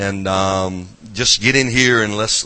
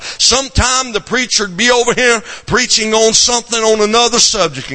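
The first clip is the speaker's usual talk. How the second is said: preaching; 6 semitones higher than usual. Acted. Preacher builds into fervor.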